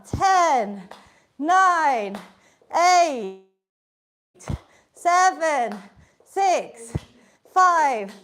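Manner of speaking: loud, punchy count called to the class; calling out countdown. Real, not acted. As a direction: call each number out to spur them on.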